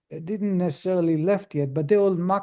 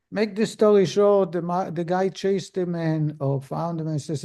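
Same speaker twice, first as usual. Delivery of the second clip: normal talking voice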